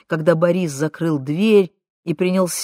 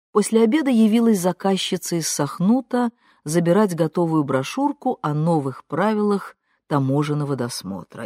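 plain reading voice

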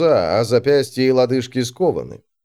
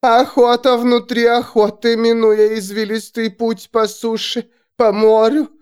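prophesying seer; 11 semitones higher, 3 dB louder than usual. A narrator's reading. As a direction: cry visions out in trance.

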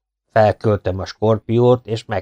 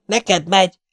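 high, alarmed cry; yelled warning; 11 semitones higher than usual. A narrator's reading. Partisan cries that the scorpion is coming, yelling. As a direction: call out urgently, pitched way up. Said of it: "üvöltötte a partizán".

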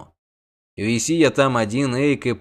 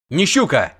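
bark; 4 semitones higher, 5 dB louder than usual. A narrator's reading. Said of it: "рявкнул Богольд".

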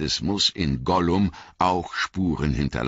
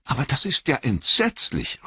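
put-on higher, anxious young hobbit voice; Frodo, dismayed; 10 semitones higher than usual. A narrator's reading. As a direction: cry out in horror as the young hobbit. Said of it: "rief Frodo".

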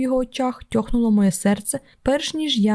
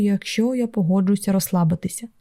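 ordinary delivery, nothing marked

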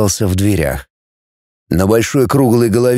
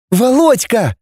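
much higher, glad cry of a name; excited calling out; 11 semitones higher, slower than usual. A narrator's reading. Girl hears her hidden friend, calls excitedly. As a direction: call out to a found friend.

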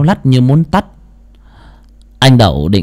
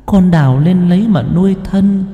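ghost mother; 7 semitones higher than usual. Acted. Ghost mother instructs son in dream.

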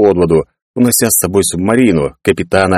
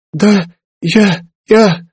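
high, halting chilled voice; shivering stammer; 14 semitones higher, much slower than usual. A narrator's reading. Soaked girl answers Clarence, shivering stammer. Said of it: "Ферби колотил озноб".

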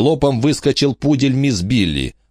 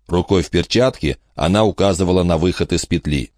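usual speaking voice

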